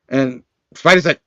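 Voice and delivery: ordinary talk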